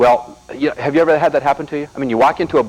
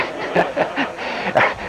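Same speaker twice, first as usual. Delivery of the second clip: laugh; 11 semitones higher, 5 dB quieter than usual. Real, not acted. Laugh after punchline.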